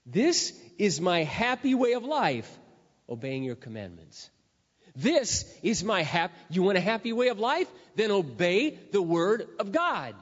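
loud, slow, high pulpit proclamation; fiery preaching. Acted. Pastor urging the congregation to obey, fiery preaching. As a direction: preach it out to the back row.

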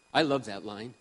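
ordinary talk

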